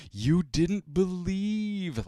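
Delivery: slow, high scolding imitation of a TV uncle; Uncle Phil. Inner voice scolds him for the fake call, slow and high. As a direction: drag out the reproach like a disappointed TV dad. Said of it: "the voice of Uncle Phil in my head going".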